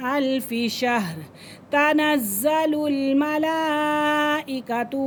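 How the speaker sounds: slow melodic tajweed chanting of the surah; full surah chanted in tajweed style; Quran recitation. Shown as a demonstration. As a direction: recite it like a qari, long drawn vowels.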